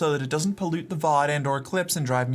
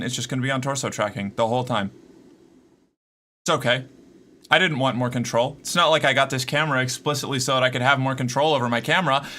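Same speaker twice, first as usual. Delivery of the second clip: ordinary delivery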